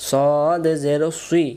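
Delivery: সুই said as "swee" after a long সদ; rounded mouth. Shown as a demonstration. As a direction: stretch সদ, then round the lips on সুই. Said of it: "মুখটা গোল". (সদ জের সুই, গোল মুখে টেনে)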